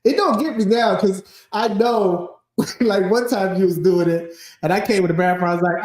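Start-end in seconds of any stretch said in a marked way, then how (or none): none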